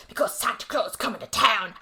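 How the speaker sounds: lightly put-on British accent for the killer Santa; a hint of a posh British Santa. Recapping the killer Santa's line to the mayor in a light British accent. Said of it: "in a very British voice goes".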